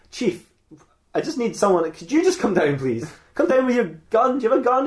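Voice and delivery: high, pleading request; exasperated plea. Acted. Asking the chief to come help.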